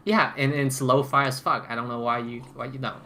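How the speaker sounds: usual speaking voice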